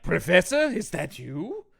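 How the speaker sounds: hammed-up astonished question from a movie scientist; astonished Frankenstein. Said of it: "and he's just like".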